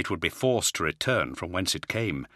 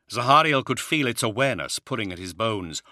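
ordinary delivery

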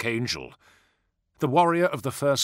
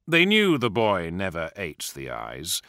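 ordinary delivery

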